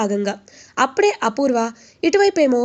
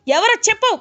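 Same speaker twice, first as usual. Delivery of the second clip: angry shout; 10 semitones higher than usual. Retold or imitated. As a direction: yell the demand at the thug. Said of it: "ఇంకా గట్టి గట్టిగా అరుస్తూ".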